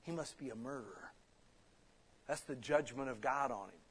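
hushed, low aside, imitating gossiping onlookers; low murmur, acted as a quiet rumor; retold whisper. Islanders whispering about the man struck by a viper, retold whisper.

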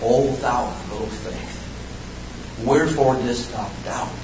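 soft, slow quoting of Jesus; hushed, solemn Bible quotation; gentle scriptural rebuke. Retold or imitated. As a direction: rebuke softly, drawing out the opening. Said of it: "and said unto him".

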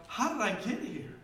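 high-pitched, baffled thinking aloud; bewildered self-questioning. Acted. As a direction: ask yourself in high disbelief. Voicing a bewildered inner question.

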